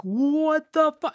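a high, slow, strangled "What the fu-"; a high, drawn-out cry of disbelief, cut short; mock outrage. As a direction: pitch it way up and cut it off. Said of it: "they're losing their shit".

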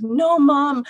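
put-on a child promising she's brave; imitating insistent daughter. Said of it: "And she goes".

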